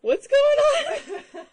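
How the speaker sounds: high, loud startled question; bewildered bystander. Acted. Game master plays accused stranger, loudly bewildered. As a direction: blurt it out in mock bewilderment. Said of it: "who you pointed at is like".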